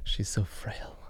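teasing whisper. Real, not acted. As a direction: whisper the joke aside. Joking about Laura.

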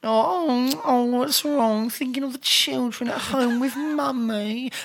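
high, sneering pity voice; mocking taunt. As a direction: taunt a pleading victim. Taunting an imagined victim.

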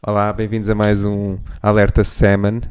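loud, upbeat on-air reporter intro. Retold or imitated. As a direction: open the segment like a TV reporter. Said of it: "isso era grande a repórter".

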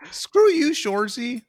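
high, loud sitcom hockey-player voice; Letterkenny taunt. Acted. Friend quoting Letterkenny, high Canadian voice. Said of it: "a Shorzy impression".

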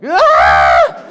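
very loud re-enacted yell; half-girly, half-manly scream. Dad re-enacting his scream when baby grabbed his face, screaming. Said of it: "halfway a girly scream, but kind of a manly scream".

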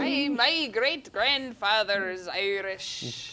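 slow, drawn-out mock Irish accent. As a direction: stretch it into a put-on Irish brogue. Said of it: "or does an Irish accent".

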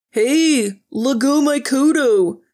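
high, loud, hammed-up Spanish accent; exaggerated Spanish accent. A narrator's reading. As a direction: cry out in a cartoonish Spanish accent. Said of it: "cried in a wildly offensive Spanish accent".

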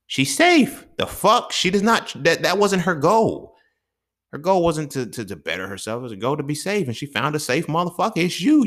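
an exasperated high-pitched tirade; heated high rant. Real, not acted. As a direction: rant it up at the top of the voice. Ranting much higher at men.

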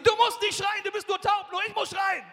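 loud, high shouted scolding; shouting wife. Retold or imitated. Wife shouting at her deaf husband over dinner. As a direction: yell back at the deaf husband. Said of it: "Sagt sie".

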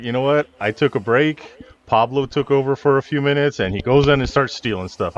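mock indignant explanation; imagined personality's excuse. Voices imagined excuse of other personality.

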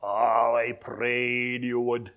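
slow, drawn-out Shetland lilt; Shetland accent. Retold or imitated. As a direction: imitate a father's Shetland accent, stretching the words. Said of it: "in that Shetland accent".

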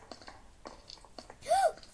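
high breathy gasp of surprise; sharp gasp. Real, not acted. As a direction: gasp in sudden delight. Finds key in game.

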